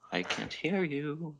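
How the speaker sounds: high, slow singsong tease; a teasing lover singing out the line. Acted. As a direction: lilt it playfully like a taunt. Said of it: "Rin sang happily".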